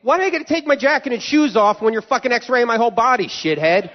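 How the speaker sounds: high, indignant tirade; worked-up comic complaint at airport security; exasperated rant. Acted. Ranting at imagined screener.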